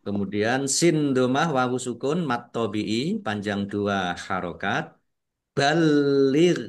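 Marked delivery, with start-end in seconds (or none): 5.6-6.6 balir with the l held long, higher and louder; doubled l in balir. Shown as a demonstration. Teacher models balir for the class, l doubled. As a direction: press and hold the l in balir. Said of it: "lamnya dibaca double".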